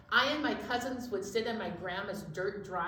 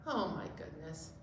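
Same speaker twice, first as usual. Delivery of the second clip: ordinary delivery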